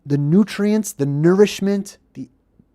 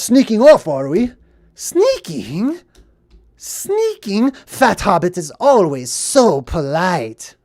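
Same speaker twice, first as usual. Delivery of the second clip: mock sarcastic; 3 semitones higher, 4 dB louder, slower than usual. Hobbit answering Sam's accusation of sneaking off, mock sarcastic. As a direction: quote it with exaggerated sarcastic swoops. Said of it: "very sarcastic".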